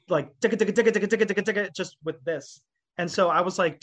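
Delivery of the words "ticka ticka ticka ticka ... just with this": rapid-fire ticka drum noises; imitated drum roll. Showing bandmates how the one-handed drumming sounds, imitated drum roll. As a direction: rattle it off like fast drum strokes. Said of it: "it sounds like".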